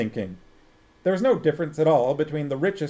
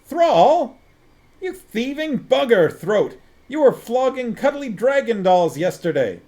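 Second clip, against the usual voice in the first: louder, higher furious shout; even louder, higher accusing shout; 11 semitones higher, 7 dB louder than usual. A narrator's reading. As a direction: top the last yell in outrage. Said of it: "shouted Vimes".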